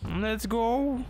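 jittery high-pitched Morty impression. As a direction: squeak it out like Morty from Rick and Morty. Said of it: "And I sound like Morty".

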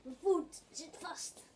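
ordinary talk

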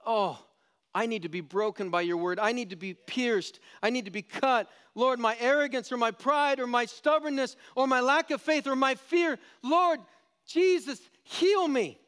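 prayer building from plain to a high, loud plea; pleading prayer. Pretend. Preacher voicing a broken sinner's prayer, rising to a loud plea. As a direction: cry out to Jesus for healing. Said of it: "or instead of going".

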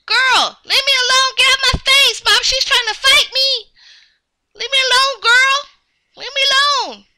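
put-on high, loud childlike protest; indignant tattling shout; annoyed yelling. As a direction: yell at a pest to back off. Quarrel with pestering girl.

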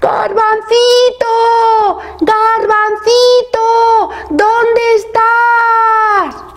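very high, long-drawn sing-song call; calling out shout. A narrator's reading. Worried parents search the forest for Garbancito, drawn-out shouting. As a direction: call far into the woods, stretching each word. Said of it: "Iban gritando".

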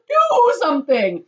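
high, loud, drawn-out scream; screamed demand. A narrator's reading. Wife urges husband to act, screamed demand. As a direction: scream it, stretching it out. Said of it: "screamed at Mister Gloop".

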